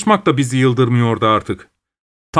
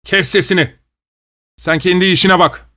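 raised, harsh snap; savage voice; 5 semitones higher than usual. A narrator's reading. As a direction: cut them off in fury. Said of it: "vahşi bir sesle".

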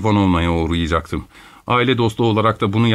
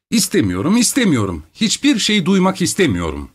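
higher pitched refusal, not much louder; a lightly raised protest; loudly; 8 semitones higher than usual. A narrator's reading. Yelena Ivanovna waving her arm, protesting in a raised voice. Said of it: "yüksek sesle".

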